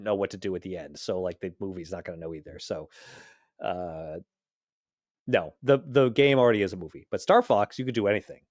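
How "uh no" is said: usual speaking voice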